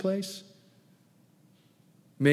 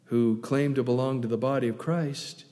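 ordinary talk

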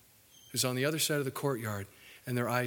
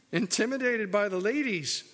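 usual speaking voice

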